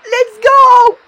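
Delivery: high, loud cheers of amazement; real excited screaming. Real, not acted. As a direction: scream with hype, drawing out the wow. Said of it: "Obligé que là je crie". (hurlements de joie admiratifs)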